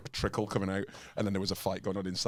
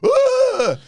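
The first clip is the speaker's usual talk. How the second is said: sudden shrill yelp of pain, much higher and louder; mock yelp; 13 dB louder than usual. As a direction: imitate a man overreacting to a kick with a sharp yelp. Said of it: "I heard this guy just go".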